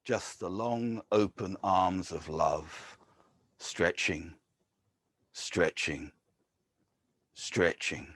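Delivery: measured, grave poetry reading; poem reading. Acted. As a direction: read it like an elegy at a graveside. Poet reads poem Incarnated.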